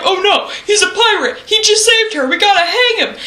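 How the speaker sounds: high, laughing mock panic; panicked townsfolk. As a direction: play the crowd in a silly fluster. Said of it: "and they're like".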